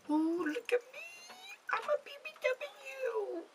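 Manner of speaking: tiny, very high silly voice; mocking squeal. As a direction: squeak it out slowly in mockery. Mocking in a put-on voice.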